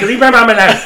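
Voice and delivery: playful put-on indignation; mock protesting friend. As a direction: imitate a friend defending his mom. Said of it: "Well, he's like".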